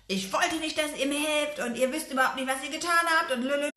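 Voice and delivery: put-on a cranky dwarf telling the girls off; cursing dwarf. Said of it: "der Zwerg flucht und verflucht die beiden".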